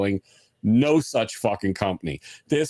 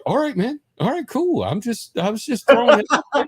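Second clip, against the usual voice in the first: defensive backing off; 5 dB louder than usual. Retold or imitated. Brother-in-law backs off when scolded.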